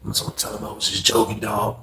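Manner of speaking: whispered, low and sly. Retold or imitated. Cellmate whispering his excuse to narrator, whispered.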